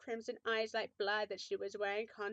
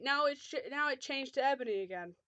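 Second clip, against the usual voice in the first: ordinary delivery, nothing marked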